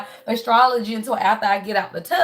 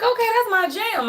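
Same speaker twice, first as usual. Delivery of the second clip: delighted squeal, pitched way up; excited; 11 semitones higher than usual. Retold or imitated. Recalling her jam playing in the tub, delighted high squeal. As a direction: gush about your favourite song coming on. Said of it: "Home came on and I'm like".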